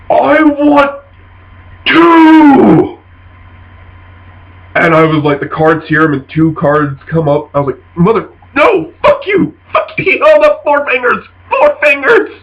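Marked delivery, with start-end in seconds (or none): 0.1-3.0 high, loud, drawn-out ogre voice; smartass ogre. Acted. Ogre answers how many things he wants, smartass. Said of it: "so being a smartass, he goes". 8.0-12.3 high-pitched mock fury; retold outrage. Player protests the four fingers to Jonathan, mad. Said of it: "I got mad at him".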